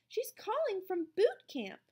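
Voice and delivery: high-pitched voiced teacher; insistent teacher. A narrator's reading. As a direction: press the message home, high. Teacher insists on the phone call.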